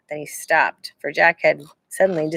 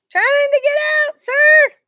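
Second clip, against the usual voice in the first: shouting up; 5 dB louder, slower than usual. A narrator's reading. Jack shouting up.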